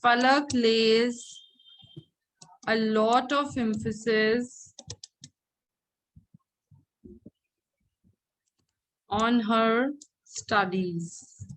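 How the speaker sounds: slow, phrase by phrase, with long pauses; slow dictation. Shown as a demonstration. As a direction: dictate it slowly for pupils to write. Teacher dictates a corrected example sentence.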